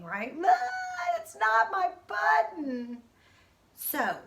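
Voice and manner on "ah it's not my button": high, slow near-sob; teacher demonstrating a crying wail; almost crying. Shown as a demonstration. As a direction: show the class how a wail sounds. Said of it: "I'm almost crying".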